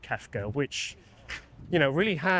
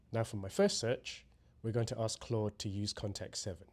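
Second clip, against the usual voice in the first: measured, softer tutorial narration; scripted demo voiceover; 5 semitones lower, 9 dB quieter than usual. Acted. Presenter walks viewers through prompting workflows, scripted demo narration. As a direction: read the walkthrough evenly, composed and lower-key.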